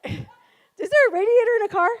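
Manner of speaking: can barely ask it for laughing. Retold or imitated. Mechanic laughing at her question.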